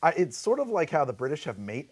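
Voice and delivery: usual speaking voice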